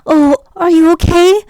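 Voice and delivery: high, gushing mock concern; hammed-up worried sweetheart voice; mock fussing lover. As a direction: coo over them like a worried lover. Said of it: "They're always like".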